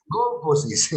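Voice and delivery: "Gonfosis" con GON como sílaba tónica; acento en la primera O. Shown as a demonstration. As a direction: carga la voz en la primera O de "Gonfosis". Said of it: "La tilde va en la primera O". ("Gonfosis" corregida, con el acento en GON)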